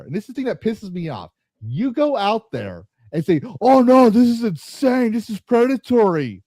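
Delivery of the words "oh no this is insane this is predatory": mock outrage. Mocking creators' fake outrage.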